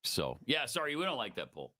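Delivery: usual speaking voice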